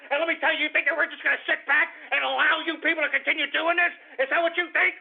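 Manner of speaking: high, strained angry tirade; outraged rant. Real, not acted. Host berating leftists over inciting violence, outraged rant. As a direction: berate them with rising indignation.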